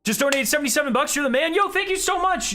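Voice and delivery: high, fast, thrilled thanks; excited donation thanks. Real, not acted.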